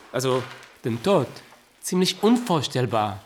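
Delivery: ordinary talk, nothing marked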